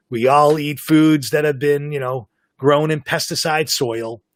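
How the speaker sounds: ordinary talk, nothing marked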